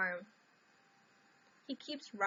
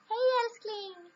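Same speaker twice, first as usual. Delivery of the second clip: sweet greeting; 10 semitones higher, 4 dB louder, slower than usual. Real, not acted. Greeting boyfriend on stream.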